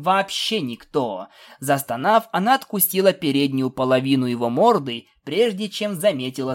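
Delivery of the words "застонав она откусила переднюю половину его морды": ordinary delivery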